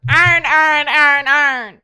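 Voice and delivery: very loud Baltimore accent mimicry. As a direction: belt it out in exaggerated Baltimore. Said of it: "Baltimore accent".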